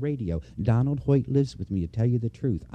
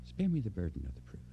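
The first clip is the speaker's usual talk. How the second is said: the same words dropped to a soft hush; quiet repeat; 5 semitones lower, 13 dB quieter than usual. Shown as a demonstration. Preacher repeating the plea to the congregation, softly. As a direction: let it sink in quietly, almost to yourself. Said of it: "Do you know what I mean by that?".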